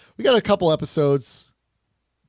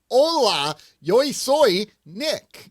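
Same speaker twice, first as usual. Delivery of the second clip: bad Spanish; 8 semitones higher, 5 dB louder, much slower than usual. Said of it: "I was just being like".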